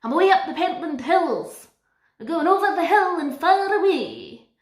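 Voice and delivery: high, loud, broad Scottish Dora voice; Dora in full Scottish accent. Pretend. Dora speaking to Boots in a loud, high broad Scottish accent. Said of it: "Could you imagine that?".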